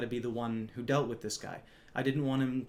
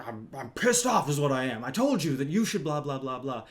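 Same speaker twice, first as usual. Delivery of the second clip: mock angry yell; 6 dB louder than usual. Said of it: "he's like".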